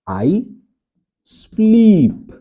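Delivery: I sleep, with sleep high and loud; stress on sleep. Shown as a demonstration. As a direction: lift sleep high and hit it. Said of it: "sleep का अर्थ होता है सोना". (sleep पर ज़ोर, ऊँचे सुर में)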